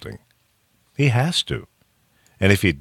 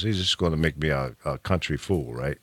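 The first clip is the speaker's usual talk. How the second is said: ordinary talk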